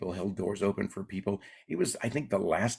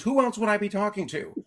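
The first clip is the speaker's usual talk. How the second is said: much higher mock exasperated wife; 12 semitones higher than usual. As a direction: throw it back, fed up. Wife snapping back.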